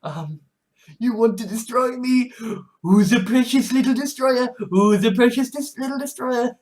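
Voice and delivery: high cooing voice to a pet; fond high baby talk to a kitten. Pretend. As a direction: coo at the little destroyer like a baby. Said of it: "it's just kind of like".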